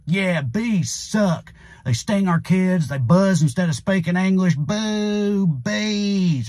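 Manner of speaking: mocking a supporter who cheers against bees; dumb supporter voice. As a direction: play the proud fool booing the bees. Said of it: "his supporters are like".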